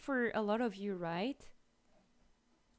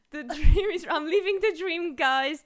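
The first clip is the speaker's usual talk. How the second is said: excited laughing; 5 semitones higher, 10 dB louder than usual. Real, not acted. Laughing joyful shout.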